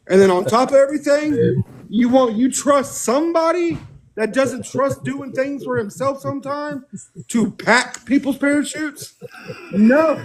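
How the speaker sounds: high, slow disbelieving rant; you can't be serious; worked-up disbelief, laughing at the end; incredulous rant. Real, not acted. Ranting in disbelief.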